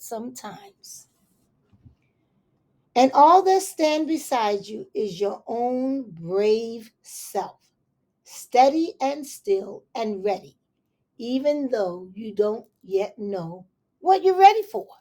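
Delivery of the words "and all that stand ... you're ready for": put-on gentle read-aloud to children; story reading. Reading a picture book aloud to children, gentle storytelling.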